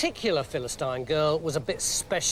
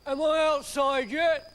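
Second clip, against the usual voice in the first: higher, innocent giant asking; Samson, dim strongman; 9 semitones higher than usual. Acted. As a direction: ask like a big simple child. Samson carrying gates.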